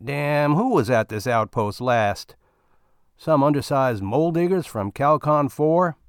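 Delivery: fed-up miner griping about the outpost; grumbling miner. Acted. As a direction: complain like a fed-up space miner.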